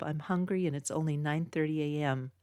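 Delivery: ordinary delivery, nothing marked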